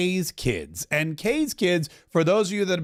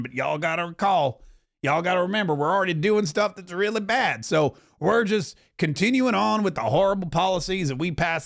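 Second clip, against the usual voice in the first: Tim Burchett impression. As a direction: talk like a down-home Tennessee congressman, a hint of Duck Dynasty. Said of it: "Why are you talking like you're from Duck Dynasty?".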